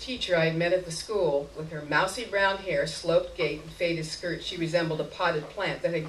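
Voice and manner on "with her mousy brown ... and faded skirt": ordinary delivery, nothing marked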